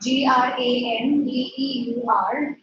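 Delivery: spells G R A N D E U R letter by letter; name each letter of G R A N D E U R in turn; spelling out. Shown as a demonstration. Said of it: "spells word for students".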